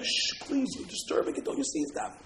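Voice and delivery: soft whispered plea; hushed pleading. Acted. Householder begging soldiers not to disturb prayer, whispering. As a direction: shush and plead under your breath. Hushing soldiers near praying man.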